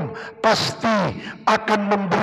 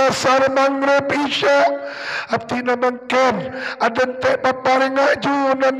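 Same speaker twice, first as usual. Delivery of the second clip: crying desperate prayer; 3 semitones higher than usual. Pretend. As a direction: beg God with growing urgency, near tears. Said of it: "sambil nangis". (doa memohon sambil menangis, makin mendesak)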